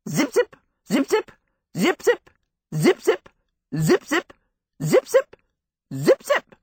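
high, loud zipping sounds; bouncing zip effect. A narrator's reading. Boots striding.

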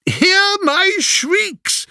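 high, slow ghostly mock hoot; a drunken suitor mocking the prophet. A narrator's reading. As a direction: play a spooky ghost to jeer.

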